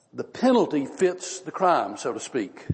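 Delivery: ordinary talk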